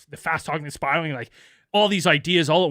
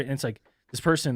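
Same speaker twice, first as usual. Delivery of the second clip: ordinary delivery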